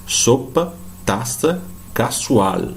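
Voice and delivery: "Sopa, taça, casual" with unvoiced S throughout; Spanish S sound. Shown as a demonstration. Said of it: "sempre pronunciada como S". ("Sopa, taça, casual" com S, nunca Z)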